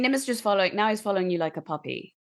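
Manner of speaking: ordinary talk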